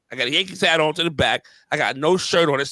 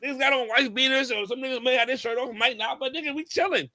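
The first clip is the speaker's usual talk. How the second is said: put-on defiant teenager; 7 semitones higher, 4 dB louder than usual. Retelling his teenage self's defiance.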